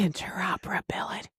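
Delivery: soft, unvoiced whisper; hushed, playful ASMR take. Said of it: "that was like A S M R".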